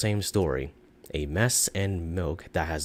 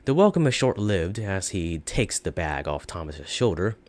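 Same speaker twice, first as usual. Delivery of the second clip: usual speaking voice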